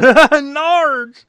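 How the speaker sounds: high, loud mock exclamation; hammed-up high yelp; gleeful movie quote. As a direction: cry it out like a kid reliving the joke. Recalling childhood hilarity, quotes line.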